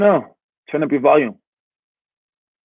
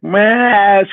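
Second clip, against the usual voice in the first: high, loud mock child's wail; imitated tantrum scream; 9 semitones higher, 6 dB louder than usual. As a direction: mimic a screaming little girl. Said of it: "screaming, screaming".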